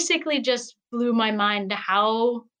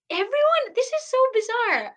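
high, startled disbelief with a gasp; gasping surprise; 12 semitones higher than usual. Real, not acted. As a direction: caught off guard, half laughing it off. Gasp at remark about her accent.

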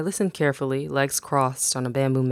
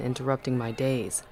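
ordinary delivery, nothing marked

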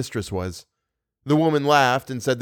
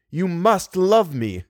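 slow, commanding, higher voice; masterful tone; 6 semitones higher, slower than usual. Acted. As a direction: hidden man commands Christine to love him, masterful. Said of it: "in a curiously masterful tone".

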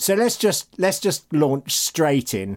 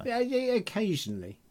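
talking between sips of tea; words right after a sip; while sipping a drink; 8 semitones higher, 8 dB quieter than usual. Real, not acted. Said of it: "I'm just drinking my hot Ribena".

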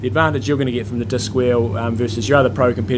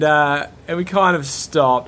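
normal talking voice

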